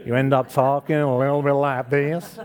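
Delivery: mock American accent, drawn from being around Americans. Preacher teasing Linda about Americans, in a mock American accent. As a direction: put on a rough American accent. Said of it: "hanging around the Americans".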